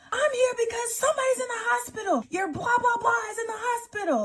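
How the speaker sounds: shouting the news at the cheater; 1 retold yell. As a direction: throw it at him, high and indignant. Confronting cheating boyfriend, shouting.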